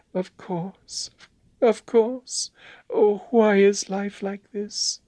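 put-on very high, plaintive girlish voice; fragile, unhappy young woman; whispered lament. A narrator's reading. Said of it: "James heard her whisper".

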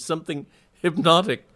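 high, loud, halting dread; spooked, with breaks between phrases; shaking voice. A narrator's reading. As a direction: stammer it out in haunted agitation. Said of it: "in a shaking voice".

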